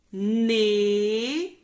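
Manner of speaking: "Ne" held long and very loud; isolated syllable. Shown as a demonstration. Teacher separating a word for pupils, "Ne" loud and drawn out. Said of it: "separar em sílabas".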